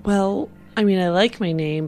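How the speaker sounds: ordinary delivery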